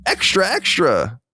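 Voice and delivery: put-on high, slow old-timey shout; old-timey newsboy. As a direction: cry the headlines like a newsie. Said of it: "like, old-timey voice".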